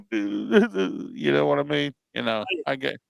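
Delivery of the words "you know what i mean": laughing. Real, not acted. Laughing at Drew McIntyre joke.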